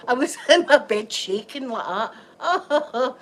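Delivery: slow, laughing through the words; giggling as she tells it, slowly; laughing at herself, drawn out. Real, not acted. Laughing about shaking in bed.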